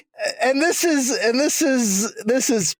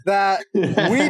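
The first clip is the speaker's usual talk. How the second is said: laughing annoyance; slower than usual. Real, not acted. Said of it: "I was very annoyed".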